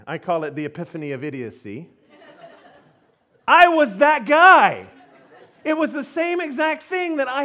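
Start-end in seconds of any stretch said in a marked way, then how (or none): 3.5-7.4 exclaimed realization. Real, not acted. Punchline of epiphany, shouted realisation.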